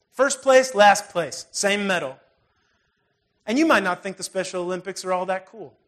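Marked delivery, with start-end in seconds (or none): 0.1-2.2 preaching at full force. Acted. Preacher urges the congregation to laugh it off, loud and rising. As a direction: hammer it home to the congregation.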